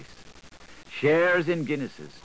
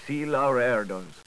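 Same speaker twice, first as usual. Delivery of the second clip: plain reading voice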